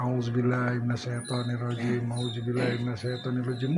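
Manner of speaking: very soft, low recitation; murmured recitation. Shown as a demonstration. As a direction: breathe the phrase out almost inaudibly. Demonstrated quiet recitation.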